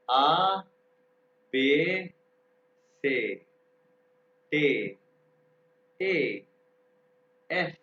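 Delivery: each letter called out loud, one by one; reciting alphabet. Shown as a demonstration. Teacher recites the German alphabet loudly for students. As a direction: drill the letters loudly with pauses. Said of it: "अब मैं दोबारा pronounce करूंगा".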